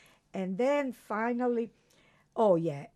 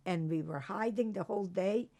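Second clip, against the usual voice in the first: ordinary delivery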